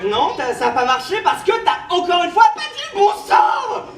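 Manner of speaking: loud, high angry yell; angry shout. Acted. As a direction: shout at her in frustration. Shouting at failed partner.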